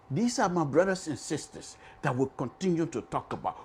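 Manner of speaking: ordinary delivery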